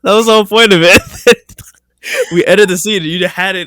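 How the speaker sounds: loud, high, cracking up; helpless laughing protest; laughing exasperation. Real, not acted. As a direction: shout it through real laughter. Breaks character cracking up at partner.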